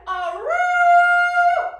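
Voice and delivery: high, loud wolf howl on a roo. Pretend. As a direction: howl it at the moon. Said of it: "howls at the moon".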